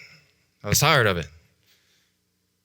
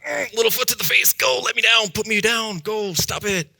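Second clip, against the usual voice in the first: put-on much higher, squealing little-kid protest; kicking protest; 11 semitones higher than usual. Little boy kicking at the man carrying him, high protest. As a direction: squirm and kick, voice pitched up high. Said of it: "I'm like".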